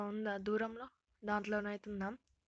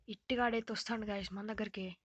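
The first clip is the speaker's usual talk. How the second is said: ordinary delivery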